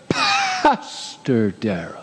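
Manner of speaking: hugely drawn-out title, dying away; hammed-up pompous self-introduction; mock self-importance. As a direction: stretch "Pastor" grandly, then let the name sink. Mocks himself flaunting his title.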